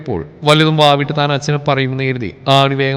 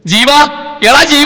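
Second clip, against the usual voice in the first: much higher, loud yell for her son; loud scream; 11 semitones higher, 5 dB louder than usual. Acted. Mother screaming from upstairs for her son, loud. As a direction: scream his name across the house. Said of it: "ഉറക്കെയുള്ള അലർച്ച".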